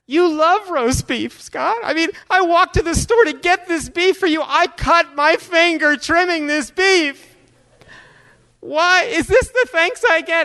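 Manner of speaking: very high, wailing mom impersonation; hammed-up guilt trip building toward sobs; tearful mother. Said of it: "Tears are welling up".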